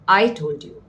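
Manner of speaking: emphatic "I", falling away on "told you"; stress on I. Shown as a demonstration. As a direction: make "I" the word that counts. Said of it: "no one else told you".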